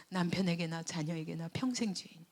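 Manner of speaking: usual speaking voice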